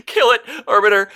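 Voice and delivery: put-on very high, stammering child's plea; crying girl. A narrator's reading. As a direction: cry it out high as a distraught small girl. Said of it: "he could make out the girl's cries".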